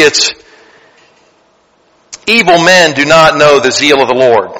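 normal talking voice

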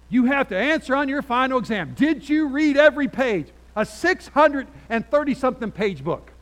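loud, high-pitched professor's demand. Retold or imitated.

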